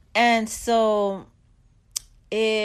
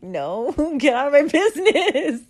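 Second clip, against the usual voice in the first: louder, higher, laughing through it; laughing brush-off; 5 semitones higher, 6 dB louder than usual. Retold or imitated. A mom laughing as she brushes off her son's quiz question. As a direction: shoo him away while cracking up.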